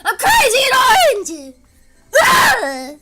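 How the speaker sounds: full-on crazy stream scream. Real, not acted. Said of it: "I'm just screaming".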